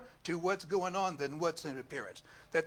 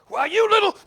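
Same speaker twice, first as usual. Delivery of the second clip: mock outrage; 16 dB louder than usual. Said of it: "And I'm like".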